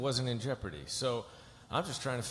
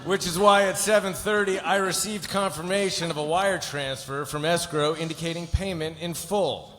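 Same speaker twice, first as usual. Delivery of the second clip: loud, higher-pitched put-on delivery; turned on its end; 5 semitones higher, 5 dB louder than usual. Lawyer telling client fee was paid, high and loud. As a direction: declaim the legal speech high and loud. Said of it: "turn it on its end and do something different".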